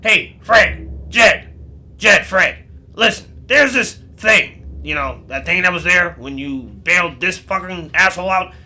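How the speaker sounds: high, loud, pushy tip-off; loud urgent warning. Acted. Rosimul tipping off Fred and Jed about the hunter, loud and urgent.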